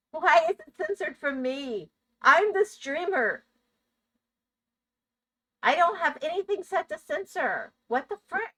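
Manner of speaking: high-pitched, incredulous protest; indignant disbelief. Real, not acted. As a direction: protest in a high voice, affronted as the streamer. Indignant at being censored on own stream.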